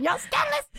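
high, mock-shocked squeal; playfully scandalized. Scolding the sneaky lovers, mock outrage. Scolding the cheaters like a talk show.

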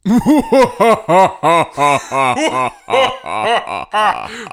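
evil villain cackle; spooky laugh. Pretend. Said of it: "can you guys do a spooky laugh".

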